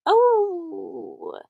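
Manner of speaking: ordinary talk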